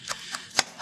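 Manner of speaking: long breathy sigh; acted sigh. Mama Bear gives in to Papa, long acted sigh. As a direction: let out a heavy put-on sigh.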